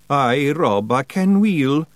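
meek Scottish reply; obedient Scots. A narrator's reading. Woolly answering Rob obediently in Scots. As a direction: answer like a chastened little brother. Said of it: "said Woolly obediently".

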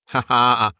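put-on short, gleeful old woman's laugh; hammed-up crone laugh; mocking cackle. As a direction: cackle over the dead man's goods. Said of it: "laughed the same woman".

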